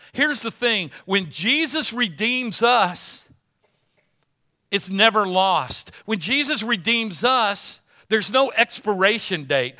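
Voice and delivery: loud, high, fervent preaching with heavy repetition; preaching at full force. Acted. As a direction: build the refrain, hammer each line.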